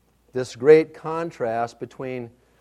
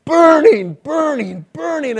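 retold panic; 11 semitones higher, 8 dB louder, slower than usual. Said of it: "I'm just panicking".

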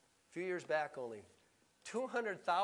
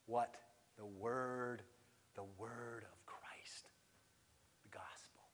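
low, soft scripture teaching; hushed preaching; 6 semitones lower, 5 dB quieter than usual. Real, not acted. Preacher quoting Romans to congregation, hushed and low. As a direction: let it sink lower, dying away almost to a whisper.

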